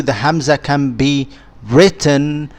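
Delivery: ordinary talk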